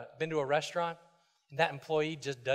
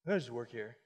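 low, soft, bored throwaway; mock indifference; 7 semitones lower, 8 dB quieter than usual. Pastor voices the careless hired-hand attitude, low and bored. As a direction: sound like a worker who doesn't care. Pastor mocks slave mindset.

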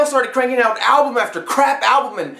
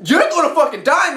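ordinary talk, nothing marked

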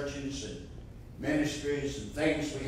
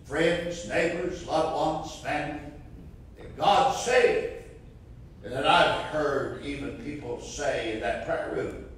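ordinary talk, nothing marked

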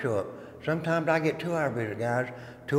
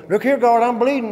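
loud, high call to the guard; mock cry for help; 7 semitones higher, 9 dB louder than usual. Stabbed inmate calling the guard, a loud mock cry. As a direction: pretend to yell for the guard.

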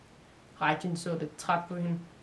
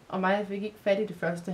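usual speaking voice

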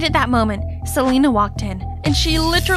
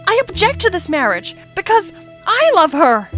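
raised, emphatic objection; defiant declaration; 6 semitones higher, 3 dB louder than usual. Acted. Sibling objecting to dad over an arranged marriage, defiant. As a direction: declare it with conviction.

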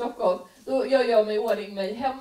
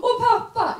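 put-on much higher, louder kid voice; child asking; 11 semitones higher, 6 dB louder than usual. Kid quizzing mom about love, high and loud. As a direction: pester like a little kid.

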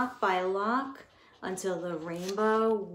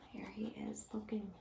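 whisper aside; 14 dB quieter, faster than usual. Real, not acted. Teacher whispering to her class while reading. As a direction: lean in and whisper it.

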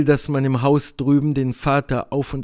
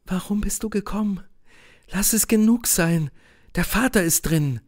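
higher woman's voice, hesitant; anxious Helene; 6 semitones higher than usual. A narrator's reading. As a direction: send him away, voice kept high. (ängstliche Helene, höhere Stimme)

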